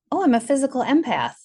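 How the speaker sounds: high-pitched retold discovery; pleasant surprise. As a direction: act out a delighted realization, pitch jumping up. Retold moment of self-discovery.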